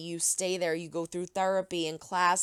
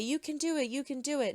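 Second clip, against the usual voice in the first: cheerleader; 7 semitones higher, faster than usual. Retold or imitated. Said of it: "and be a cheerleader".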